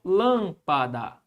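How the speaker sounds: "Lâmpada" slowly split as LÂM-pa-da; syllable separation. Shown as a demonstration. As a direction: say "Lâmpada" in three separate beats, leaning on LÂM. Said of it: "Fica assim, ó". ("Lâmpada" devagar, separando LÂM-pa-da)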